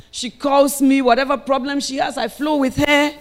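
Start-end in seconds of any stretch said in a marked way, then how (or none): none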